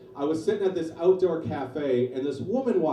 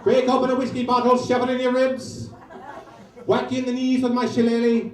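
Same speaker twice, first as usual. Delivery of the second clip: hammed-up Irish brawler threat, higher and louder; mock Irish rage; 6 semitones higher, 4 dB louder than usual. Comic threatening the barfly as a mock Irish thug. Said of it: "Could you be any more Irish".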